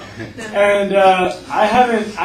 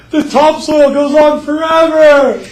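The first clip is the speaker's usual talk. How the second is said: high, loud, slow cry of joy; hammed-up farmer in awe of his dirt; ecstatic farmer; 10 semitones higher, 11 dB louder than usual.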